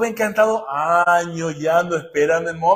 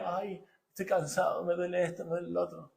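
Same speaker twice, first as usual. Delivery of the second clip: soft, whining sigh of a tired man, much softer; mock whiny complaint; 13 dB quieter than usual. Preacher mocking a complaining man, soft whine. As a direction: mimic a weakling moaning about his aches. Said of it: "no anda llorando por los rincones".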